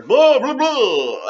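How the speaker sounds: high, loud cartoon vampire. Shown as a demonstration. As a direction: ham up a silly vampire. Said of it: "That's a vampire".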